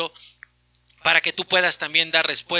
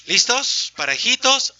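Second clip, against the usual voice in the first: normal talking voice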